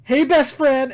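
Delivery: playfully overdone hail to a buddy; mock-excited greeting. Podcaster playfully hailing his best friend, mock-excited.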